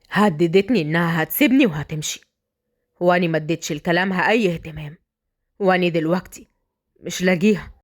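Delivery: low, subdued confession; man who lost his wife; troubled husband. Acted.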